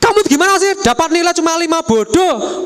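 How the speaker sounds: high, harsh scolding over a bad grade; angry mother. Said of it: "sekali Anda marah". (ibu memarahi nilai jelek, nada tinggi)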